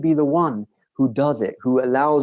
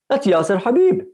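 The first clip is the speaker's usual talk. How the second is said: sudden recognition; 11 semitones higher, 4 dB louder than usual. Retold or imitated.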